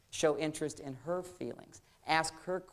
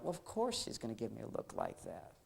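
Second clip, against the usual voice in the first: calm self-talk; 10 dB quieter than usual. Acted. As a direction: reason it out quietly to yourself. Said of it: "And I stand there like".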